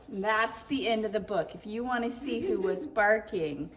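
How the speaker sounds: ordinary delivery, nothing marked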